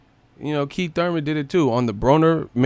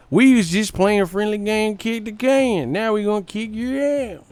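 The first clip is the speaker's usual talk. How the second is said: hammed-up Texan; 7 semitones higher than usual. Texan impression continues.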